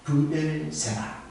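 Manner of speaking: 브엘세바 drawn out syllable by syllable, falling; slow spelled-out name. Shown as a demonstration. Preacher teaching the well's name, 브엘세바 said slowly. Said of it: "뭐라고?".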